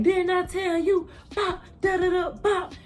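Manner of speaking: high sing-song scolding with bops; scolding parent. Retold or imitated. Mimicking a parent whipping a kid, sing-song scold. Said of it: "She's like".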